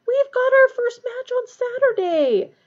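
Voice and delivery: high, loud, drawn-out protest; outraged. A narrator's reading. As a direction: wail it in indignant disbelief.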